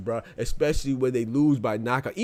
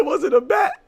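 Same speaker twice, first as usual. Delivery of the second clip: high, loud quote through laughter; quoting him while cracking up; laughing quote; 7 dB louder than usual. Retold or imitated. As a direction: laugh out the mock question. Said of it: "He said".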